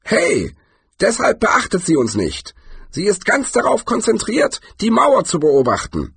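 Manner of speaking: put-on high-pitched old warrior, explaining eagerly; high, insistent character voice; old hero's character voice. A narrator's reading. Voices Cohen in the story.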